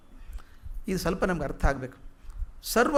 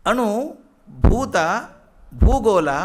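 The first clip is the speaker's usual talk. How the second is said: emphatic exclamation; 3 semitones higher, 6 dB louder, much slower than usual. Acted. Re-exclaims the verse words.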